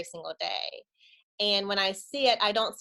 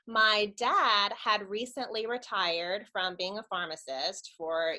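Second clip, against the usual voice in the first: normal talking voice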